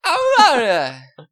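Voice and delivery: high, loud hammed-up "ow" yelps; imitating a singer's ad-lib yelps; mock rock yelps. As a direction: yelp like a glam rock singer. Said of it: "So he'll be like".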